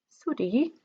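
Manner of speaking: a quiet sulky retort; grumpy thief. Acted. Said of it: "And Hugh said".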